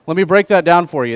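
usual speaking voice

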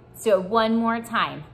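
ordinary delivery

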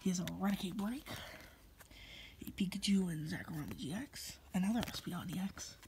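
hushed secret whisper. Real, not acted. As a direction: whisper so he can't overhear. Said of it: "Yes, I'm whispering 'cause he can probably hear".

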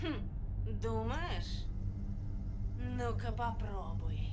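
slow, deliberate challenge; taunting dare. Acted. Guard daring Maggie to move him, slow taunt. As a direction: drag it out, daring her to try. Guard dares fugitive to push past.

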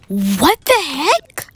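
loud, drawn-out, rising outrage; outraged disbelief. Acted. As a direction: exclaim in indignant shock, stretching it out. Girl outraged at sister's date.

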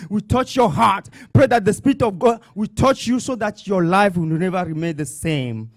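normal talking voice

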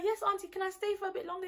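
high, quick put-on voice for the friend; imitating polite friend. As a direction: mimic her asking auntie sweetly. Friend sweet-talking auntie to stay.

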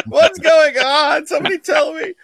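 high-pitched wail of fake fright; hammed-up whimpering panic; mock panic. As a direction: imitate someone helplessly spooked in the dark, laughing. Mocking friend confused in dark room.

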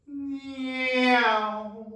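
high, loud, sliding car-passing noise; car whoosh. Shown as a demonstration. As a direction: imitate a car zooming past, high and loud. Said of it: "Try that for me".

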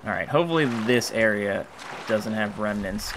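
ordinary talk

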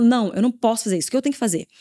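usual speaking voice

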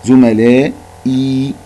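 زملائي said very slowly, the long i held out; stretched vowel demo. Shown as a demonstration. Teacher showing pupils the long vowel in زملائي, slowly. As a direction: hold the madd in زملائي long. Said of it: "فيها مد بالياء".

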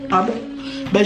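ordinary delivery

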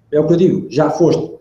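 usual speaking voice

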